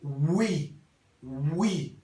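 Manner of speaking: "wi" as one gliding syllable; U-I en criollo. Shown as a demonstration. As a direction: glide from U into I on "wi". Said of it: "la U y la I". ("wi", U e I en una sola sílaba)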